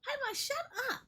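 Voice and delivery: high-pitched, exasperated outburst; exasperated telling-off. Real, not acted. Player scolding a game character for talking, exasperated. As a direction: cut them off in a high, squeezed voice.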